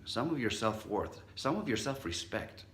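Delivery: ordinary delivery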